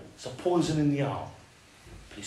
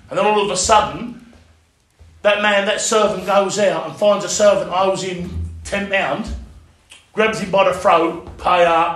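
loud preaching; 3 semitones higher, 5 dB louder than usual. Real, not acted. Preaching the parable loudly.

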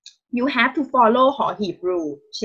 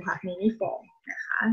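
whisper; 2 semitones lower, 13 dB quieter, faster than usual. Real, not acted. Teacher aside to class.

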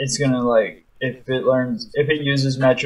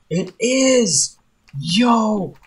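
high, thrilled and gleeful; excited; 7 semitones higher than usual. Real, not acted. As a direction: celebrate the lucky find. Thrilled about needed item.

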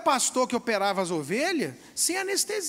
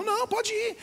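higher, quick dismissive send-off; brushing-off doctor; 10 semitones higher than usual. Retold or imitated. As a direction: shoo him out the door. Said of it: "Aí o cara falou". (médico dispensando agudo)

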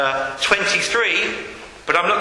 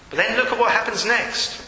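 usual speaking voice

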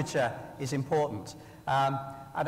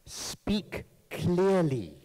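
each word spaced out slowly; slow, pointed advice; slow and deliberate; 2 semitones higher, much slower than usual. Shown as a demonstration. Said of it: "This is a lesson to all of us".

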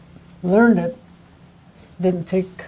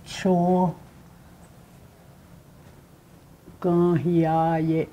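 "Cho ga hiya ye" drawn out, pausing after "Cho"; stretched "Cho", long gap, then slow "ga hiya ye"; syllable breakdown; 6 dB quieter, much slower than usual. Shown as a demonstration. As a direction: sound out "Cho ga hiya ye" slowly for learners. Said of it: "It's a breakdown".

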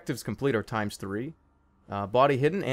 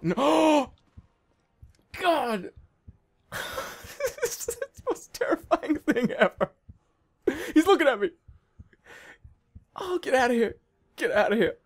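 high, gasping, real terror; freaking out; 11 semitones higher than usual. Real, not acted. Gamer being looked at in the closet, terrified. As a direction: gasp and squeal, begging him to go away. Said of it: "I'm freaking out right now".

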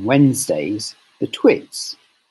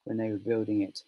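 ordinary delivery

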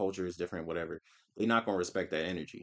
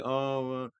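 mock crying; 5 semitones higher, 4 dB louder, slower than usual. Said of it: "Somebody would cry and be like".

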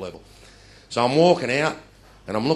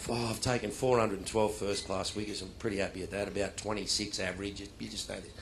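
softer, pleased murmur to himself; soft proud reverie; quiet self-satisfied musing; 4 semitones lower, 7 dB quieter than usual. Retold or imitated. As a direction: savour the number privately. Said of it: "and I".

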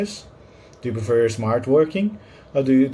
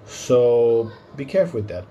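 ordinary delivery

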